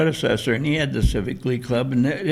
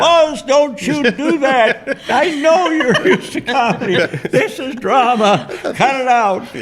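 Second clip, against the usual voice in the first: loud, higher-pitched retold telling-off; mock scolding; 10 semitones higher, 5 dB louder than usual. Director tells comic actor this is drama, mock scolding. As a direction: scold with laughter creeping in.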